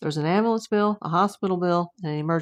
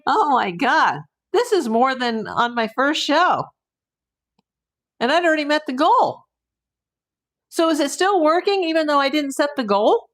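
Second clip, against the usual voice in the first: excited; 8 semitones higher, 5 dB louder than usual. Real, not acted. Overjoyed at donations.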